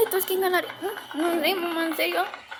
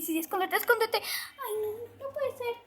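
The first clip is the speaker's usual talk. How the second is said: ordinary talk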